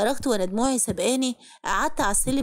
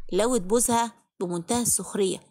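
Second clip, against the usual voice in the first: plain reading voice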